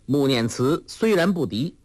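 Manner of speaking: ordinary delivery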